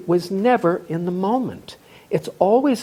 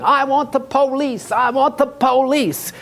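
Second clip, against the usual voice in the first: imitated yell, pitched much higher; mock scream; 9 semitones higher than usual. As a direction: squeal the demand like a hysterical man. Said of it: "he kept screaming".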